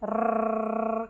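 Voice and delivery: long rolled "rrr", imitating children; imitated tongue trill. Teacher imitates children droning a rolled R, long tongue trill. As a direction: roll the R on and on in one steady drone. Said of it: "ऐसे धुन एक पकड़ लेते हैं".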